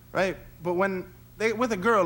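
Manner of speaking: usual speaking voice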